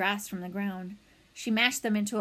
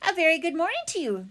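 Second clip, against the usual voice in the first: higher, quick cheery robot; brisk, pitched-up friendly call; friendly, booming greeting; 7 semitones higher, 4 dB louder, faster than usual. A narrator's reading. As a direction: hail someone across the water in a friendly voice. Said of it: "the robot's friendly voice boomed over the water".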